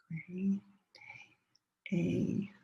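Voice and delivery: soft whispered "Gray day" under the class. Shown as a demonstration. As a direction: whisper it so the students' voices carry. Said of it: "second time say it together with me".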